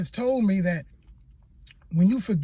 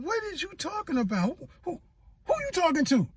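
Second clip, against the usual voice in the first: higher, startled questioning; confused; 7 semitones higher than usual. Retold or imitated. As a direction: ask it higher, thrown off guard. Speaker retells his confused reply.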